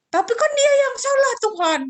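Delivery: put-on child arguing with God that the other is wrong; whiny protest. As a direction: complain like a sulky kid. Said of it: "kita ngomong gitu".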